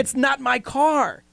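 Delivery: high, slow, insistent protest; exasperated protest. Retold or imitated. Host defending his yelling to the co-host, exasperated.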